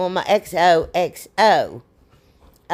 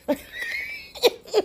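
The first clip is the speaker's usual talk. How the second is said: high squeal of a laugh at herself, then quieter; 6 dB quieter than usual. Real, not acted. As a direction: burst into a high giggle after fumbling the word. Laughs at her own stumble.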